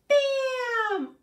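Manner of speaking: very high, loud bam; shrieked sound-effect bam; shouted bam. Acted. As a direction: burst out like a popping pan. Rhyme punchline sound.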